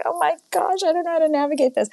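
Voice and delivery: high, fast, flustered squeak; mock overwhelmed newcomer, high and fast; flustered panic. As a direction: blurt it out in a high panicked rush. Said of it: "And I was like".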